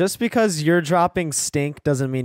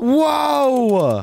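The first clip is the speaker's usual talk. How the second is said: long, high, loud whoa of wonder; amazed whoa; 12 semitones higher, 5 dB louder than usual. Real, not acted. Friend stepping into a secret portal, amazed. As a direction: draw out a big, high gasp of awe.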